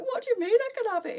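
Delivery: high, loud, tearful disbelief; retold blubbing. Wife stunned in the shop by husband's gift, tearful. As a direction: squeak it out like you're crying in the shop. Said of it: "in the shop blubbing".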